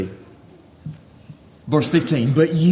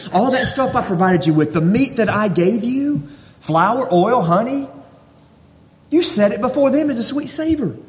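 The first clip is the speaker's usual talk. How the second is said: high, driving preacher voice, pitch climbing; impassioned preaching; 8 semitones higher than usual. Acted. Preacher rebuking the idolatrous nation, impassioned high-pitched preaching. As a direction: preach it at full force, rising higher. Preacher rises to full force.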